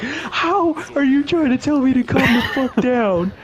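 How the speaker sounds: mock panicked outburst, high-pitched, breaking into a laugh; freaking out. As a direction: squeal it in hammed-up panic. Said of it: "freaking out".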